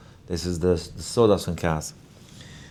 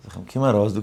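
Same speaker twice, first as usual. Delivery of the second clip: ordinary delivery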